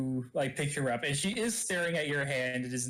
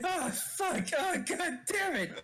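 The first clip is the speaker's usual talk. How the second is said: mock pain. Punched character reacting to the blow, mock pain. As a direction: curse in hammed-up pain.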